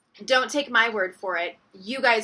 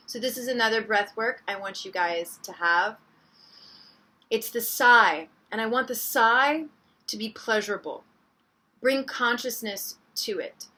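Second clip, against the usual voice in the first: guided meditation; 2 semitones lower, 4 dB quieter than usual. Acted.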